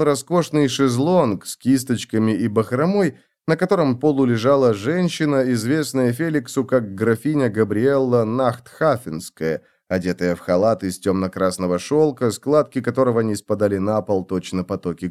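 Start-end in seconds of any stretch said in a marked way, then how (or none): none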